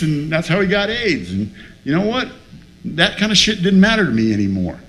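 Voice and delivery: ordinary delivery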